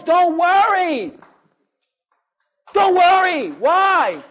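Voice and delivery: a pastor building to a peak; full-force preaching. Acted. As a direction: drive it home to the congregation. Preaching call and response.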